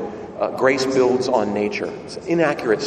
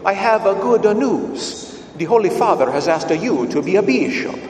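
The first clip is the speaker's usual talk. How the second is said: put-on thick Italian accent; 5 semitones higher, 3 dB louder than usual. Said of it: "who in a thick Italian accent says to you".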